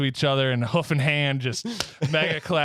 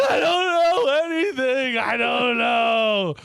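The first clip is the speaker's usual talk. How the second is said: high, wailing terrified Easter Bunny; panicked bunny; 14 semitones higher, 4 dB louder, slower than usual. Acted. Easter Bunny begging for his life above the volcano, high panicked wail. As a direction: squeal it out like he's dangling over lava.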